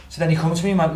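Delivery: ordinary talk, nothing marked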